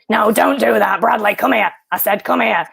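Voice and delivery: Sean Dyche voice. Retold or imitated. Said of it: "you sound like Sean Dyche".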